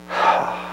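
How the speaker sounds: quiet unvoiced sigh of sorrow; weary sigh; soft sigh. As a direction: let out a soft grieving sigh. God grieving over Saul.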